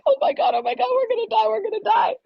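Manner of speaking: high, loud mock panic; hammed-up terror at dying. Panic in stranger's car.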